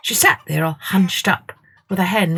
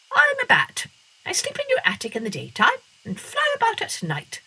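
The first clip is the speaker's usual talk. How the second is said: much higher, then softer bat voice; squeaky bat; 3 dB quieter than usual. A narrator's reading. Bat introducing itself to Masha, high.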